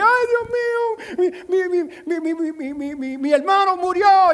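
mock mourner's wail, pitch soaring; wailing in Spanish. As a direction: wail it like a grieving sister crying out for her brother. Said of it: "They're, you know, wailing".

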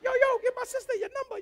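high, quick streetwise guy asking; young guy voice. Retold or imitated. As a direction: play a cocky young dude. Imitating a guy asking for number.

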